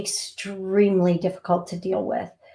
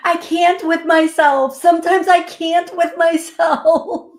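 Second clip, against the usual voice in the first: much higher, louder, laughing through it; 9 semitones higher, 7 dB louder than usual. Real, not acted. Telling viewers she can't with herself, laughing. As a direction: giggle at your own silliness. Laughing at her own blunder.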